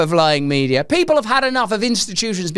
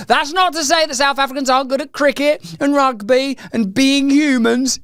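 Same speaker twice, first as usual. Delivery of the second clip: ordinary talk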